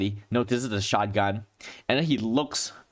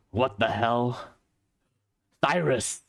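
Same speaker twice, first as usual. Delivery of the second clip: familiar voice; 2 semitones higher than usual. Acted. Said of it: "a familiar voice goes".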